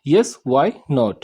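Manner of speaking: very slow, each word spaced out; slow teaching read. Shown as a demonstration. Teacher slowly modelling the English for students. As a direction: spell it out slowly for learners. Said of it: "इसका होता है English".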